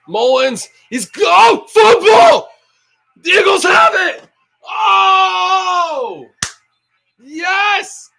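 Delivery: excited shout. Real, not acted. Fan watching his team recover a fumble, real excited shout. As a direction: scream it as your team recovers.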